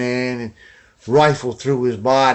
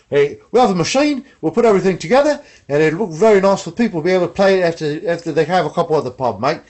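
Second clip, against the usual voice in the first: quick, higher, louder Aussie-style factory boss; mock Australian accent; 3 semitones higher, 3 dB louder, faster than usual. British guitar maker pitching machine-made guitars, hammed-up Australian accent. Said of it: "It sounds like Australian".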